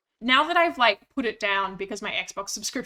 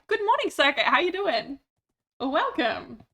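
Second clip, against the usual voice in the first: high, bright hello; sing-song welcome up high; cheery greeting; 5 semitones higher, 3 dB louder than usual. Real, not acted. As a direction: beam at a viewer arriving. Greeting a chat member.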